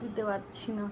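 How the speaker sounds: sore throat voice. Real, not acted. Sore throat, speaking softly. (গলা ব্যথায় নিচু, নরম স্বরে কথা)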